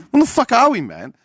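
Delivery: high startled cry of disbelief; mock-shocked squawk; shocked outburst. Mock bank interrogation, startled.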